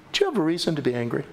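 low, quick, measured voice of God; God questioning. Acted. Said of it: "And God says".

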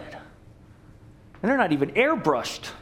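ordinary delivery

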